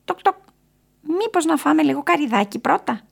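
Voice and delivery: high, pecking bird voice; woodpecker character. A narrator's reading. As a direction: tap out the knocks, then chirp the reply.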